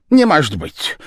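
high, loud cry of disbelief. A narrator's reading. The Japanese man crying out at the gentleman, high and loud. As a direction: shout in mock shock. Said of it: "вскричал японец".

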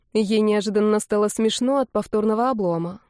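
usual speaking voice

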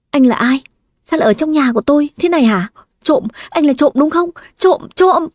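rising to a high panicked yell of thief; accusing shout. Acted. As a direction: shout at the stranger, building to a cry. Accuses intruder of being thief. (quát lên hoảng hốt, càng lúc càng cao)

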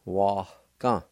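Wa-ka with a faint breathy H before the K; aspirated wa-ka. Shown as a demonstration. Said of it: "it's aspirated like this".

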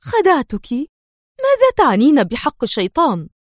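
incredulous, angry retort; indignant anger. A narrator's reading. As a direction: fire the question back indignantly. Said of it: "وهي تقول في حنق".

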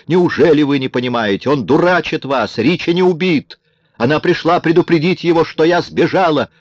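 high, insistent angry tirade; furious cry. A narrator's reading. Kara tries to convince the gang, furious. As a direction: keep the pitch up in exasperated anger. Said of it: "с бешенством закричала Кара".

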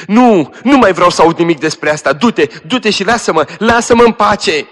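high, loud frantic pushing away; dying girl frantically sends her cousin away, high and loud; frantic dismissal. Acted. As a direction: drive her out in panic.

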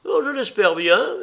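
high, loud society lady; lively old lady's voice; old hostess. Acted. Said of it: "dit Dorian l'hôtesse".

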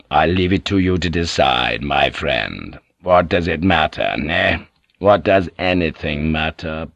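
Toranaga's voice. Acted. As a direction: speak down to a retainer, unhurried.

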